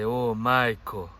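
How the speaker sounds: loud, slow, drawn-out "Oh, Maiko"; mock passionate moan. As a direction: stretch it out like a hammed-up cry of pleasure. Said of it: "I'll probably say".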